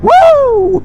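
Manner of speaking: high, loud thrilled whoop; excited whoop. Real, not acted. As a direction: let out a joyful woo. Second sport mode blast.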